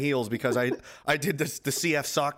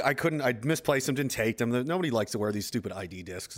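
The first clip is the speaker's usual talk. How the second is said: usual speaking voice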